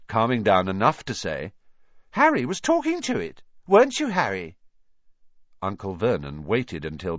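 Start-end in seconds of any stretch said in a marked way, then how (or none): 2.1-4.6 put-on high, sly accusing kid; tattling kid voice. A narrator's reading. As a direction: tell on Harry slyly. Piers accusing Harry.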